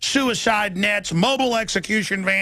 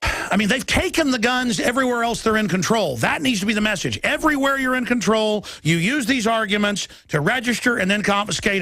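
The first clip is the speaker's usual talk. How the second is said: ordinary talk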